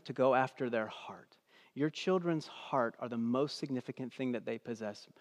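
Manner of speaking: hushed, intimate whisper. Real, not acted. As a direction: lean in and whisper it. Sermon drops to intimate point.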